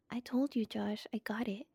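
hushed whisper. Real, not acted. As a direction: whisper it under your breath. Whispered reassurance to cohost.

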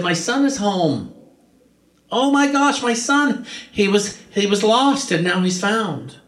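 high, rising cries of joy at the son's return; overjoyed father. Acted. Father hugging his returned son, overjoyed and pitched high.

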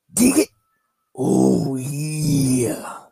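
throat-ripping Oh yeah catchphrase; Macho Man yell. Retold or imitated. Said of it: "that hurts your throat".

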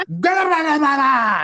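high, garbled screech of nonsense; imitated meltdown, words mashed into noise; garbled mock yelling. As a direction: screech out babble as if too enraged for words. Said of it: "a garbled mess".

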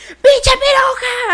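high, loud, drawn-out shriek; imitated scream. Woman screams as the door opens, imitated shriek. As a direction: shriek the insult, stretching it out. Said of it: "se escucha así de que".

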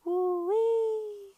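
high, loud siren imitation; woo-wee siren; siren wail. Siren coming through.

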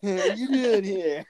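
high, slow drawled mock Californian line; imitating an SNL Californian. Quoting the SNL sketch to the co-host, mock Californian. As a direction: ham up a Valley-style greeting. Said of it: "The Californians".

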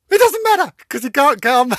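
very high, loud laughing punchline; squealing the punchline; shrieking with laughter. Real, not acted. Delivering her own punchline while shrieking with laughter.

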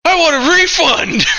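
high, loud laughing shout for a refund; angry demand. Acted. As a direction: yell it at the bar, half laughing.

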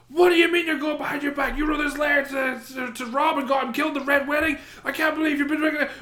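high-pitched mock outrage, rushing; heated argument. Imagining the sisters' huge argument, high mock shouting. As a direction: shout it like a big overblown row. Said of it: "having this huge argument".

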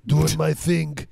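a hint of a breezy movie star; lightly voiced Arnold. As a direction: play him shrugging it off, easygoing. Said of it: "he's just like".

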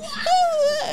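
high, loud falsetto scream. Retold or imitated. Retelling the song's climax to friends, imitated high scream. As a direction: squeal out a singer's climactic high note. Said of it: "when he was like".

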